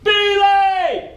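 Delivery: single word yelled high and loud; petty officer halting the haul with a yell; shouted command. Retold or imitated. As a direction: snap the stop order at full voice. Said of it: "And then he shouted".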